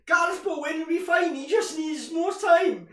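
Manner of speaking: hammed-up fan shouting in his team's defence; Ty from Arsenal Fan TV. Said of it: "it would be Ty ... arguing".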